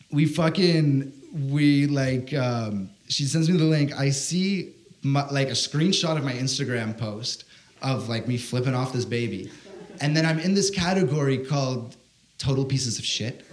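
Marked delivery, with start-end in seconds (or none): none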